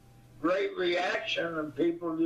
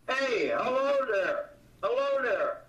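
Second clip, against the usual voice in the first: fans quoting catchphrase; 6 semitones higher than usual. Retold or imitated. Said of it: "laughing and saying".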